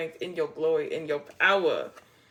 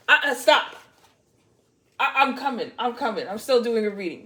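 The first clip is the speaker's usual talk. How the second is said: loud, higher scold to the dog; scolding pet; 3 semitones higher, 7 dB louder than usual. Real, not acted. Reader scolding her interrupting pet, loud. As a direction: shoo the pet away firmly.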